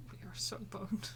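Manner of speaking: unvoiced doom-laden whisper. Real, not acted. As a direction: whisper in mock despair. Despairing whisper.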